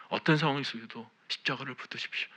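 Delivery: loud, ringing preacher's exhortation; fervent sermon delivery; full-force preaching. Acted. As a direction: preach it out with conviction to the whole church. Preaching to the congregation.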